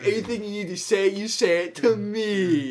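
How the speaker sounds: high, loud imitation of a domineering counselor; hammed-up commanding yell; mock bossy rant. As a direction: rush in, then stretch the last words loudly. Continues [name]'s bossy rant.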